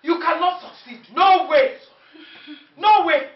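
loud, high, triumphant defiance; defiant shout. Acted. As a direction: shout her down. Mother confronts daughter-in-law.

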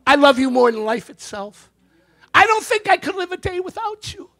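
high-pitched mock devotion; adoring wife. Wife out of the blue to husband, rising gushy devotion. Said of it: "the wife goes".

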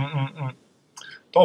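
usual speaking voice

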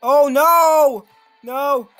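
loud high cries of dismay; frustrated yelling. Real, not acted. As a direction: yell out in frustration. Caught by monster, loud cries.

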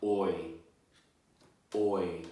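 oi said slowly and clearly as one vowel sound. Shown as a demonstration. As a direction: model oi clearly for children. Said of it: "the digraph is".

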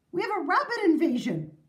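high, emphatic mayor's announcement; alarmed mayor. A narrator's reading. Mayor warning the town meeting, emphatic alarm.

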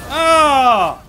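high, drawn-out yell; long loud cry. Real, not acted. Player in mid-fight, long loud yell. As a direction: holler through the blow.

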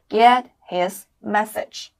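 very slow, clear phrase; slow model phrase. Shown as a demonstration. Teacher presents the phrase to students, drawn out. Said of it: "所以我们可以怎么说呢".

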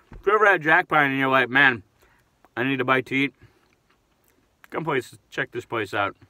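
chewing while talking; words muffled by food; mouth full of fries. Real, not acted. Seen 2: Fries held at mouth at +0.4 to +1.8, then hands empty.

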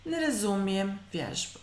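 normal talking voice

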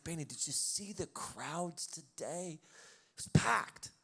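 slow, drawn-out mock praise, packed breathed out loud; hammed-up voice of God bragging on the crowd; mock excited God. Pastor imitating God gushing over a packed Sunday, hammed-up and slow. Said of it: "He's like".

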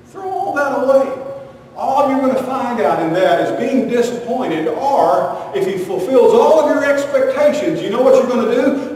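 loud sermon build; preaching loud. Acted. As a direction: drive the point home from the pulpit. Preaching rising to a climax.

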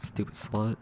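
very soft grumble under the breath; muttered insult. Real, not acted. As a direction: mutter it as an aside. Insult under breath during game.

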